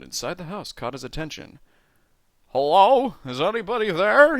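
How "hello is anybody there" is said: loud, high-pitched hail; shouted call. A narrator's reading. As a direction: shout to whoever is behind the door. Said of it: "he shouted".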